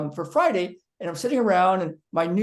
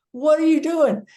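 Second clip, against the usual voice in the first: high and loud, baffled; retold bewildered challenge; incredulous demand; 8 semitones higher, 6 dB louder than usual. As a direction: demand to know what she's playing at. Incredulous question to friend.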